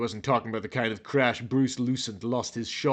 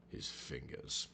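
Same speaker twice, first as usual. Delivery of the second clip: breathy whispered echo, much softer; 12 semitones lower, 22 dB quieter than usual. Shown as a demonstration. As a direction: hush it like a secret. Dramatic repeat of a phrase.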